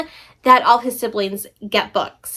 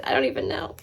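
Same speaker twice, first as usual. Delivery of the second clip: whispered aside; 8 dB quieter, faster than usual. Real, not acted. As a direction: whisper to herself, forgetting the title. Forgot the title, aside.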